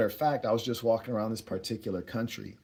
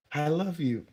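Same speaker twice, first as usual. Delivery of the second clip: higher, lightly put-on female voice; imitated woman; 6 semitones higher than usual. Woman repeating her parting words, lightly imitated higher voice. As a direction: lift the pitch to mimic her lightly.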